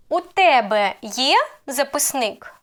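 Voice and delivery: є swept upward, записник falling; question intonation. Shown as a demonstration. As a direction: ask it with a sharp lift on є. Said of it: "So I would ask".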